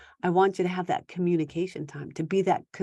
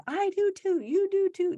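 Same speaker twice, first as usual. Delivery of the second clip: put-on high, childish back and forth; imitating a shouting match; mock bickering; 13 semitones higher than usual. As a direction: bicker like a squabbling kid. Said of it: "no, no, no, like that".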